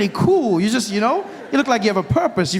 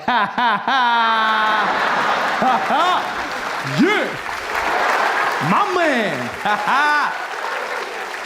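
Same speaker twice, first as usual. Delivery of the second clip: a put-on hearty laugh, ha ha; 4 semitones higher, much slower than usual. Comic showing the audience his practiced cool laugh.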